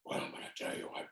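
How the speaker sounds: whispery, quick, hushed movie-star rasp; Clint Eastwood. Retold or imitated. Actor imitating his co-star once cameras roll, whispered voice. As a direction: drop to an unvoiced whisper. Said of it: "he was talking like this".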